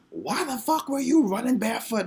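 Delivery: high, loud imitated demand; acted-out cousin's shouted question; mock outraged yell. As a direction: burst in yelling, incredulous. Cousin bursts in demanding an answer.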